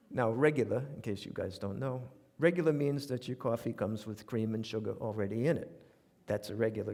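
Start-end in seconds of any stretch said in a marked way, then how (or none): none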